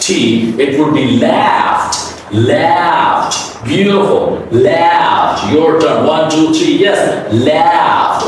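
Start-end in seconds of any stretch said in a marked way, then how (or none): 1.2-3.6 'laughed' said as laft; one-syllable 'laughed' with a light final T; soft T ending. Shown as a demonstration. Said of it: "pronounce final D as a soft". 4.5-5.5 'laughed' modelled as laft; long vowel in 'laughed', then a light T; soft T ending. Shown as a demonstration. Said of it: "pronounce final D as a soft". 7.3-8.3 'laughed' modelled as laft; long open vowel in 'laughed', soft T; soft T ending. Shown as a demonstration. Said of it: "pronounce final D as a soft".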